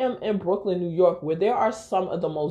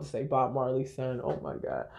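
whispered aside; 6 semitones lower, 10 dB quieter than usual. Real, not acted. Embarrassed at her own slip.

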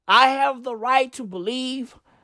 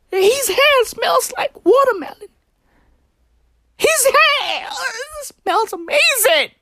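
mock infatuated swoon; 9 semitones higher than usual. Voicing Chris Pratt's infatuation.

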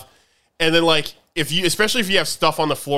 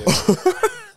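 high giggle. Real, not acted. High laugh after joke.

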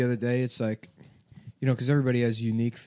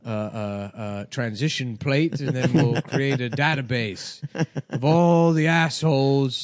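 pompous Victorian gentleman; 3 semitones higher, slower than usual. Acted. Inventor presenting in 1800s.

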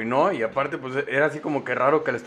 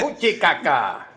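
mock disgust; 4 dB louder, slower than usual. Se burla con asco.

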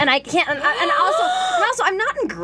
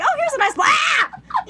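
high slow delighted voice; delighted stranger; 14 semitones higher, slower than usual. Retold or imitated. Imagined homeless person finding the blanket, high delighted. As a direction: sing it out like a happy find. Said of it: "a homeless person was like".

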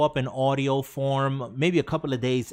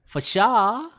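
put-on higher, louder valley girl; 8 semitones higher, 5 dB louder than usual. Host reading a chat message in a Valley Girl voice. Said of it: "in a Valley Girl voice".

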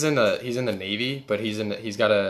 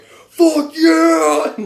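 high, loud drawn-out cheering yell; 17 dB louder than usual. Retold or imitated. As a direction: yell it out like soldiers cheering an airstrike. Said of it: "they're just yelling".

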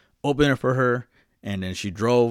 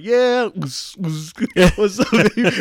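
1 tipsy high greeting; 10 semitones higher, 6 dB louder, slower than usual. Retold or imitated.

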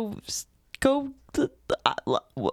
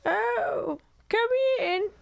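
high falsetto granny welcome; fake grandmother voice; 12 semitones higher, slower than usual. Wolf disguised as grandma inviting the girl in, falsetto. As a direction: invite them in with a squeaky granny voice.

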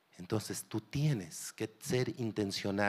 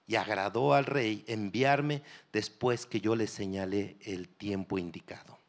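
normal talking voice

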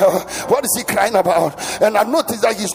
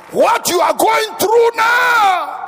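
high, loud, long-drawn preacher's shout; crying out; 13 semitones higher, 8 dB louder, much slower than usual. Real, not acted. Preacher calling to struggling congregation, drawn-out shout. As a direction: stretch the last word into a cry.

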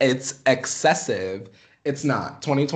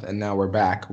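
normal talking voice

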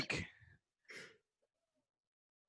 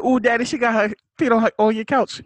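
high mock girlfriend voice; 9 semitones higher, 6 dB louder, faster than usual. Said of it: "He said".

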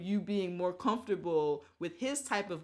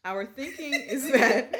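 slow, loud, put-on corporate voice; 6 semitones higher, 8 dB louder, slower than usual.

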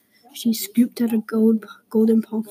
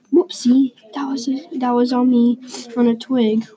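ordinary delivery, nothing marked

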